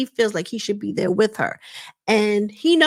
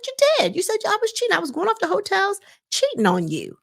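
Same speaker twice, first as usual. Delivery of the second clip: mock accusation, pitched up high; accusing; 11 semitones higher than usual. Mel accusing her ex-husband of calling her a cheater, pitched up high. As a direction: jab the accusation back at him.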